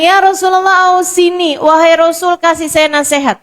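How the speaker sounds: put-on earnest young man begging for counsel; youth asking for advice. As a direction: plead for advice, voice lifted. Young man pleading with Prophet.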